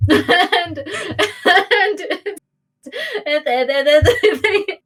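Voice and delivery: real laughter breaking up the words; giggling, losing composure. Real, not acted. Game master cracking up at own park crimes scene, giggly. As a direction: stammer through a fit of giggles. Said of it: "I need to calm down".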